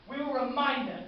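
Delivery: high, loud battle promise; forceful rallying vow. Acted. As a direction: shout the vow to rouse the troops. Rallying refrain, growing in force.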